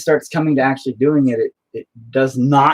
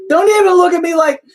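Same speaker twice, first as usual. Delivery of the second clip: mock outrage; 6 dB louder than usual. Mock offense at teasing friends.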